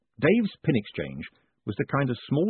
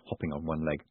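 plain reading voice

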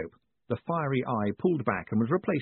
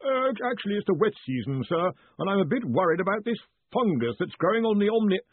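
nervous caller; 10 semitones higher than usual. A narrator's reading. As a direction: announce yourself timidly to a superior far away. Worried Collarbone answers.

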